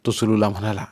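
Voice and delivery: ordinary delivery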